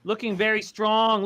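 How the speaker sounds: ordinary talk, nothing marked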